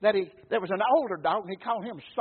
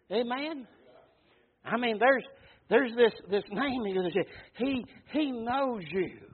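ordinary talk

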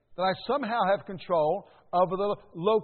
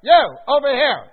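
put-on loud, high call to the teenagers; yelling across a park; 8 semitones higher, 14 dB louder than usual. Chaperone yelling at teenagers at Busch Gardens, loud call. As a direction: shout to get their attention. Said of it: "I had to yell".

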